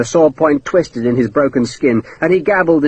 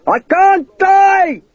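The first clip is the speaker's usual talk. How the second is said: much higher, drawn-out slow yell; triumphant shout; 3 dB louder, much slower than usual. Acted. Hakeswill yells up at Sharp from the snake pit, triumphant slow shout. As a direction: crow your victory from the pit. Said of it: "he shouted triumphantly".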